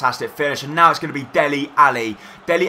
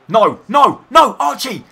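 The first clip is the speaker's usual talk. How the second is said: loud, higher cries of alarm at the keeper; panicked shout; 7 semitones higher, 6 dB louder, slower than usual. Real, not acted. Gamer yelling at his goalkeeper Archie in real panic. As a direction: yell no at him as he nearly blunders. Goalkeeper makes a risky play.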